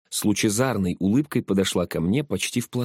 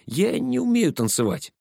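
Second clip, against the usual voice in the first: higher-pitched excuse; trying to wriggle out; 14 semitones higher than usual. A narrator's reading. Slav dodges Inga's pull to the dance floor, protesting. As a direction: protest your way out of it, voice raised in pitch. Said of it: "попытался выкрутиться я".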